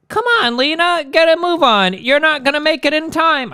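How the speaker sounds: high-pitched attempted English accent; bad English accent. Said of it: "I can't do the English accent".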